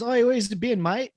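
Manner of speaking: put-on joke-telling Aussie accent; Australian accent. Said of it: "got the Australian vernacular down perfectly".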